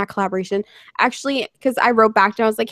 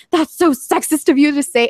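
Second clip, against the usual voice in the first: higher imitation of an outraged commenter; mock indignation; 4 semitones higher, 4 dB louder than usual. Quoting a commenter jumping to her defence, mock indignation. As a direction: play up the offence.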